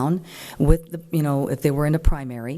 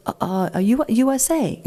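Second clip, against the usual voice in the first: higher, halting, stammered guess; mock the candidate fumbling an answer; unsure guess; 7 semitones higher than usual. The candidate guessing the answer to the board, halting and unsure. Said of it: "all of a sudden he goes".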